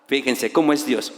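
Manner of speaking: normal talking voice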